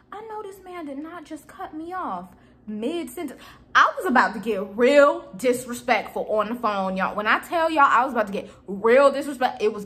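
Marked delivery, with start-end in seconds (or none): 0.1-3.4 seething hush. Retold or imitated. Hushed disbelief after outburst.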